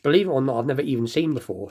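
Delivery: ordinary talk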